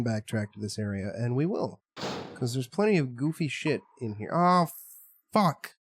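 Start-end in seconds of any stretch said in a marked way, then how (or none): 4.3-5.8 loud startled swear; startled curse. Real, not acted. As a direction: jump and swear. Sudden scare in game.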